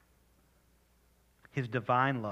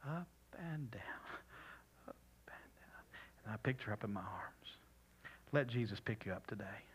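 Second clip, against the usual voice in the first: whispered; 12 dB quieter than usual. Real, not acted. Soft whispered story, gentle prayer.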